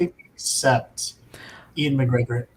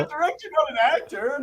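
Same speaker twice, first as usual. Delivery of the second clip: much higher, squeaky protest; joking protest; 13 semitones higher than usual. Real, not acted. Cohost protesting in a high mock whine. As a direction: whine it in jest.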